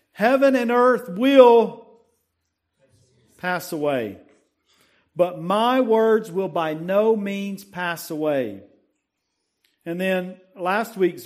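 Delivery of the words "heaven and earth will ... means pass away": raised, declaiming verse reading; preached scripture recitation. Acted. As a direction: proclaim the verse to the congregation.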